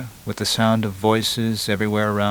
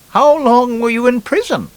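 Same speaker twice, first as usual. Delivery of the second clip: very high, louder lady voice; lady in a joke; 7 dB louder than usual. Acted. Lady questions a freed convict on the beach, high mock lady voice.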